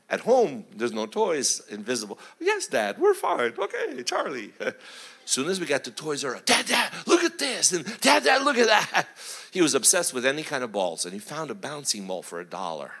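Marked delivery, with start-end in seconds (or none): 2.4-4.5 high-pitched imitation of a child; agreeable little boy. 6.4-9.1 high, eager kid imitation; clamor for dad's attention in a high voice; excited child. Child excited in toy store.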